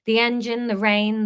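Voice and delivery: ordinary delivery, nothing marked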